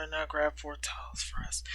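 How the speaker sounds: soft unvoiced narration; whispering. A narrator's reading. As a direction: whisper the line.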